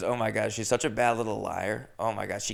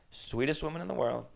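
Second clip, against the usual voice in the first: normal talking voice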